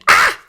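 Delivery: loud, sudden scream of pain; sharp cry; short scream. A narrator's reading. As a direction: scream as if kicked. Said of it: "Claire screamed".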